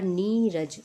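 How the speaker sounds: नी रज with a drawn-out "nee"; long-vowel demo. Shown as a demonstration. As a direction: stretch नी in नी रज. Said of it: "दीर्घ में बदल दिया जाता है".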